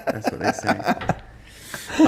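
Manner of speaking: slow, breathy laugh through the words; giggling over a cheeky joke. Real, not acted. Laughing after cheeky saying.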